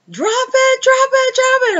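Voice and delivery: high shrill repeated command; panicked mother shouting drop it; mom screaming. Retold or imitated. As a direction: yell it at the kid in a panic.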